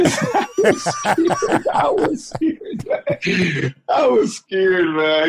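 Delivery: high, slow laughing "I was scared"; words squeezed out through real laughter; laughing hard. Real, not acted. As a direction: crack up while admitting the fear.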